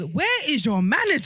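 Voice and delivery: loud and high, exasperated; where is your manager, shouted with a high, indignant pitch; incredulous outburst. Real, not acted. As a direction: demand it in loud disbelief. Outraged mock demand about PR.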